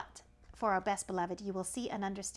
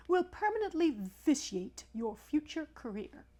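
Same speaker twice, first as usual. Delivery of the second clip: pompous python, a touch higher; snake voice; 6 semitones higher than usual. Acted. Python rock snake addressing the elephant's child, lightly voiced. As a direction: lecture the young traveller grandly.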